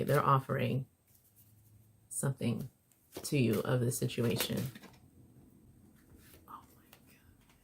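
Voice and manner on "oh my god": breathless hushed whisper. Real, not acted. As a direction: whisper it in awe. Reacting in awe to the cards.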